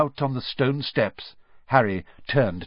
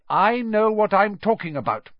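short; 11 semitones higher, 4 dB louder than usual. A narrator's reading. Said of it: "she said shortly".

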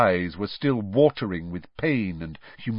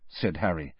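plain reading voice

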